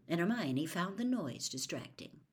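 ordinary delivery, nothing marked

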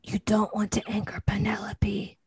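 hushed warning whisper. Pretend. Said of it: "why are we whispering".

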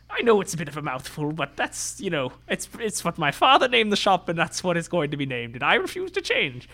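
high, prim defensive shopkeeper; squat little tailor voice. Acted. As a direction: insist primly like a fussy little tailor. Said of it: "speaks in a voice like this".

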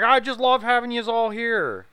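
mock dinner speech. Movie character toasting guests at dinner, mock heartfelt. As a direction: play a sentimental host, stretched and hammed up.

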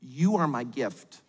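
low-pitched husband voice, slowing at the end; earnest husband. Acted. Husband telling his wife he needs no present, earnest. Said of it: "the husband who told his wife".

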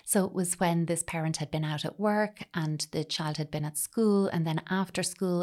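normal talking voice

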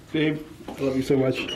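soft, tender and low; sweet nothings. Real, not acted. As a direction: murmur it lovingly to your partner. Loving words in the elevator.

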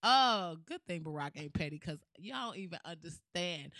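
normal talking voice

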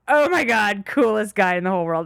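mock childlike awe. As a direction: gush like an impressed kid, hammed-up. Retold childhood awe of drunk man.

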